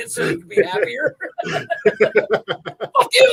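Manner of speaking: high, laughing yell; laughing shout. Real, not acted. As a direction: blurt it out laughing. Sudden laughing outburst.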